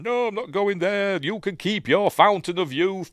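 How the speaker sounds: high-pitched, put-out protest; indignant refusal. Acted. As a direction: refuse outright, pitched well up. Acting out Bernie's angry refusal.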